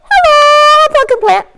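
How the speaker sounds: high squeaky little sprout greeting; tiny sprout voice. Pretend. Storyteller voicing a sprout to children, high and squeaky. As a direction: chirp hello as a baby plant.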